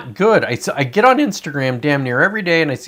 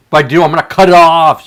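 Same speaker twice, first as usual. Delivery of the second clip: louder, defiant declaration; defiant outburst; 3 semitones higher, 10 dB louder than usual. Acted. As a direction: announce it boldly to the chat. Defiant reply to imagined viewers.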